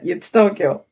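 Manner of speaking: ordinary talk, nothing marked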